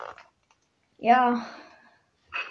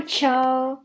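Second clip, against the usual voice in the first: ordinary talk, nothing marked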